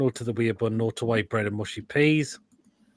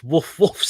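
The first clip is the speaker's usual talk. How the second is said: mock barking; 5 semitones higher, 7 dB louder than usual. Barking at a picture of food.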